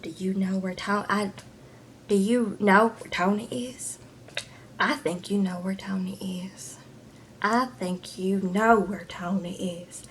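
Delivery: creepy mock whisper; menacing whisper. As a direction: lean in and whisper the threat. Menacing the supervisor in a recap.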